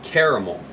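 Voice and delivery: "caramel" in three syllables, KAIR-uh-mul; echoed pronunciation. Retold or imitated. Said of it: "No one says caramel".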